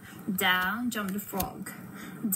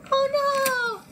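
high, loud storybook alarm; exaggerated high-pitched dismay; mock dismay; 6 dB louder than usual. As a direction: gasp it out in pretend horror for a child. Reacting to broom snapping.

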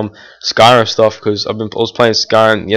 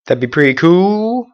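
higher, rising "cool"; light up at the idea; hopeful gamer; excited; 8 semitones higher than usual. Real, not acted. Excited pitch rise.

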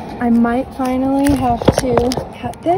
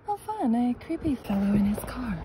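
normal talking voice

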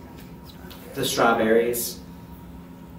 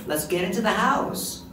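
calling over; 8 semitones higher than usual. Retold or imitated. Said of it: "they're just calling me over".

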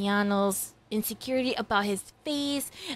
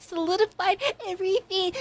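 normal talking voice

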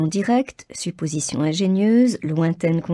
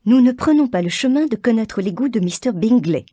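higher, louder bitter snap at her husband; mother answers her husband with bitterness, higher and louder; bitter retort; 5 semitones higher, 6 dB louder than usual. A narrator's reading. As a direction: let the bitterness cut through. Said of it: "répliqua la mère avec amertume".

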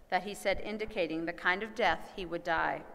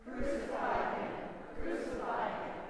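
high, whispered outcry; breathy stage-whispered chant; crowd cry, hushed; 6 semitones higher, 4 dB quieter than usual. A narrator's reading. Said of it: "they cried out".

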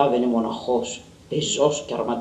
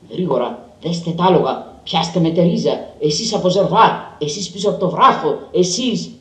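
loud, higher barked orders; curt commands; 6 semitones higher, 9 dB louder than usual. A narrator's reading. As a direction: rap out orders to the men. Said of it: "Με κοφτές προσταγές".

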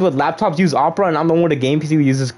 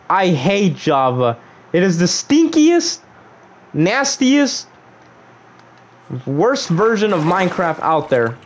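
slow, drawn-out rant rising high; hammed-up disgust; 4 semitones higher, slower than usual. Gamer tells viewers how much Java stinks, exaggerated disgust. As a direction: stretch out each insult, pitched up.

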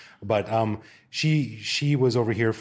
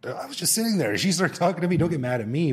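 high, pleading innocence; defensive protest; 8 semitones higher, 4 dB louder than usual. Retold or imitated. To an angry friend at the cafe, high pleading innocence. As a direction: protest that you did nothing wrong. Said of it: "And I'm like".

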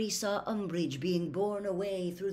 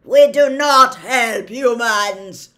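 slow, very loud, raised snarl; 6 semitones higher, 19 dB louder, slower than usual. A narrator's reading. Centaur holding Harry refuses help, slow loud snarl. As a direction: snarl each word with contempt. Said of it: "snarled the centaur".

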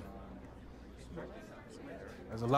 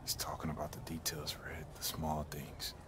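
whisper; 5 semitones lower, 8 dB quieter than usual. Acted. Teammate whispers hint to Red.

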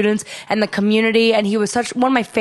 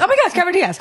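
high excited retelling; a second starstruck gasp; 9 semitones higher, much faster than usual. Retold or imitated. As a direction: relive the shock of spotting her. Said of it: "I'm like".

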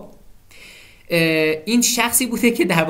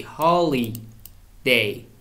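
slow drawn-out word; 4 semitones lower, much slower than usual. Shown as a demonstration. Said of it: "teacher spells out the word slowly".

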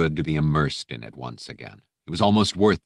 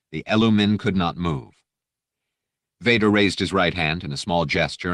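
ordinary delivery, nothing marked

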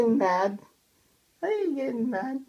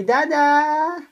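raised, held-out call; drawn-out last vowel, pitched up; calling for Dada; 2 semitones higher, 5 dB louder than usual. Shown as a demonstration. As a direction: show how to call dad, loudly. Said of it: "How do you call your dada?".

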